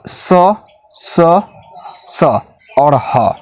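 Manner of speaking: the three sa sounds and ha, each said clearly apart; teacher demonstrating the hissing consonants; sibilants demo. Shown as a demonstration. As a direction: sound out स, स, स and then ह one by one. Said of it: "अब इसको देख लेते हैं".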